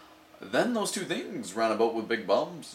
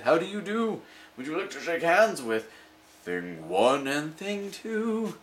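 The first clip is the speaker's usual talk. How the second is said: polite creatures; 7 semitones higher than usual. Acted. Things greet children.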